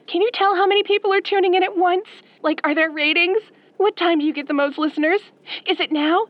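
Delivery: fast, breathless string of questions; eager fan firing off questions quickly; rapid fire questions. Acted. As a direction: rattle them off without stopping. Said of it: "that was awfully rapid fire".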